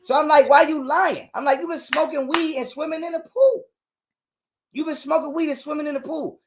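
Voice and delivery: high-pitched heated retelling; worked up. Real, not acted. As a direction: push it high with indignation.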